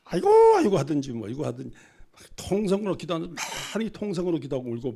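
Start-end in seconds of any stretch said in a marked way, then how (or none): 0.1-0.8 very high, loud keening 아이고; loud funeral wail. Shown as a demonstration. Pastor showing the congregation how to wail at his funeral, loud keening. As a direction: wail 아이고 like a grieving mourner. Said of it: "막, 막, 막".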